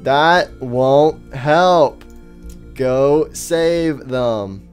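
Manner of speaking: very slow, drawn-out words; odd halting speech. Acted. Faceless stranger excuses his way of talking, odd halting speech. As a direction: stretch and separate every single word. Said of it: "why do you talk like that?".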